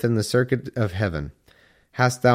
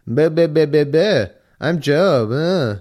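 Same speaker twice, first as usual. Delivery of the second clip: loud, high, dopey voice mocking Job; hammed-up dumb Job voice, louder and higher; 5 semitones higher, 4 dB louder, slower than usual. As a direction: sneer it like a kid making fun of someone. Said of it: "That's what he said".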